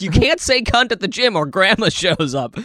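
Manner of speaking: normal talking voice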